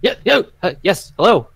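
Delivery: high, loud, caught off guard; a stablehand fumbling a greeting; flustered stablehand. Acted. Stablehand startled by visitors, flustered and loud. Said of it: "and he's like".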